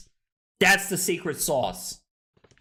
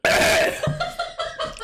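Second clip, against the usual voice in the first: sharp coughing after a gulp; coughing fit. Real, not acted. Streamer choking on spiked water, coughing. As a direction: hack and cough hard.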